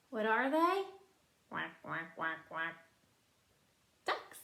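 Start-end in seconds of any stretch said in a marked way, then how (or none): 1.5-2.7 duck quacks. Retold or imitated. Duck quacks imitated for child.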